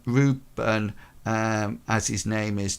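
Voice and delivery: ordinary talk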